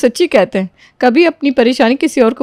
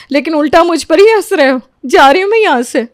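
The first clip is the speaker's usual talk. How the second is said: high, louder hurt anger; offended outburst; 6 semitones higher, 3 dB louder than usual. Acted. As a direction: announce you are leaving in a huff. Aunt storms off offended.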